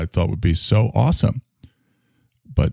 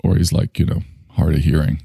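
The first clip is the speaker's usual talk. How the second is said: ordinary talk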